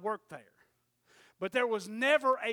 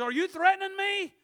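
higher, louder startled question; alarmed question; 9 semitones higher, 8 dB louder than usual. Retold or imitated. The boss startled by the threat, higher and louder. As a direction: recoil at the threat.

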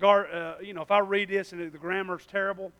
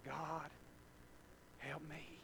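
tearful prayer; 6 semitones lower, 26 dB quieter, slower than usual. Retold or imitated. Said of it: "crying and saying".

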